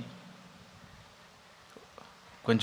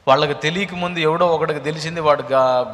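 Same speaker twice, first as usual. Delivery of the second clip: loud, high sermon rant; fiery preaching; 4 semitones higher, 10 dB louder than usual. Acted. Preacher warning the congregation about hidden affairs, loud and rising. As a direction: thunder the warning at the crowd.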